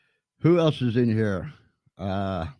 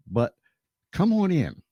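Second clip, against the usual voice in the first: ordinary delivery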